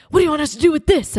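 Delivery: hurried, mildly frantic retold question; flustered helper. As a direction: imitate someone rushing in flustered. Flustered helper running in mid-crisis.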